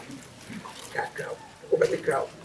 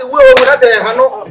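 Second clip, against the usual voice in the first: mock exclaim; 6 semitones higher, 10 dB louder than usual. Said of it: "I say".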